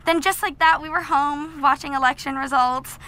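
ordinary talk

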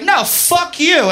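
high, drawn-out indignant refusal; raging. Retold or imitated. Woman raging at the man who insulted her, high and slow. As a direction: lash back in acted outrage, pitch way up. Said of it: "the outside is fucking raging".

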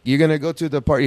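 over-enunciate like talking to someone who doesn't speak English; mock loud accent. Mocking a coworker who put on an accent with Spanish speakers, lightly faked. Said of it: "he would speak with an accent".

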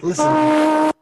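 slow, loud, high shout; loud call. Acted. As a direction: call everyone to listen.

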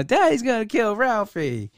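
a hint of a panicked kid from the movie; Christmas Story quote. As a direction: toss off the film line in a put-on voice. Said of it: "It reminded me of, like, the Christmas Story".

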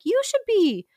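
hammy holy man giving advice; mock preachy advice. Rasputin voiced advising royal family.

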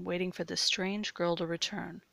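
plain reading voice

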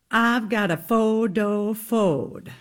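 lightly drawn-out Southern belle accent on "I've got a photo phode"; a hint of a slow Gone with the Wind Southern drawl. Shown as a demonstration. Demonstrating the Southern accent drill from Gone with the Wind. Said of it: "the phrase they had to say over and over to get in their Southern accent".

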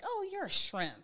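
high-pitched mocking taunt; taunting brother. Brother teasing his short mom, mocking. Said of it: "And my brother was like".

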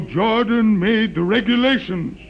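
priestly storyteller's liturgy; solemn recitation. Acted. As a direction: intone it like an ancient creed. Witness recites the sacred legend.

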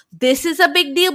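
rising, indignant "This is a big deal"; mock indignant repeat. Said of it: "the way she says".

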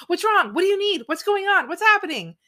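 high, loud, frantic questions; stressed questioning. Retold or imitated. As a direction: imitate a panicky onlooker demanding answers. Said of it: "It stresses me out".

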